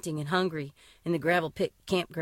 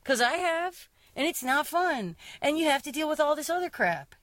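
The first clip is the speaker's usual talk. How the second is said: high, pointed mock comeback; pointed retort; 6 semitones higher than usual. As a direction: fire back at the sexist poet. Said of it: "so that we can be like".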